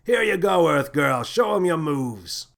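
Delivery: captain tossing the core to Lily; jovial captain. A narrator's reading. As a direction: show off the kid proudly.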